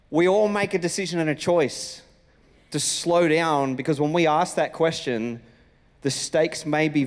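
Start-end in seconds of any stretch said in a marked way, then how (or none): none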